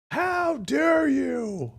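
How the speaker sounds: high, very slow preacher's outraged cry; imitating the preacher's outraged how dare you; mock preacher shout. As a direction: drag it out like a fired-up pastor. Mimics preacher clip just played.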